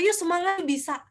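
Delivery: upbeat rallying call, pitch lifted; encouraging cheer. Shown as a demonstration. Speaker demonstrating lively intonation to the audience, cheering. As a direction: spur the audience on. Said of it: "ada juga yang harus kayak mengacu".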